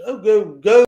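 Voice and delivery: loud, high retold urging; urging parent. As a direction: shoo the kids along like a parent.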